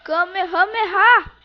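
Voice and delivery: loud battle cry; attack shout. Acted. Calling out special attack.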